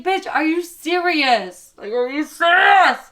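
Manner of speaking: loud, high disbelief building to a drawn-out yell; incredulous, hammed-up yelling; mock outrage. Acted quarrel over spent rent money.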